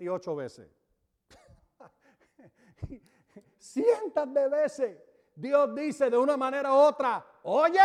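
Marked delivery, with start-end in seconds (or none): 3.6-7.8 rising preacher's cry. Acted. Preacher rising to God's call.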